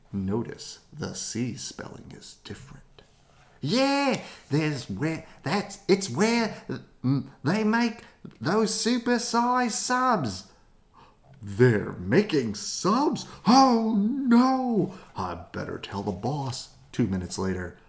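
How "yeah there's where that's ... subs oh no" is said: high character voice. Acted. Reporter character voice.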